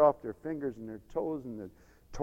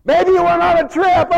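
loud, high taunting shout; jeering; 18 dB louder than usual. Acted. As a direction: yell the mockery at the prophets. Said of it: "he jeered him".

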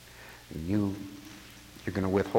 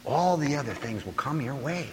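ordinary talk, nothing marked

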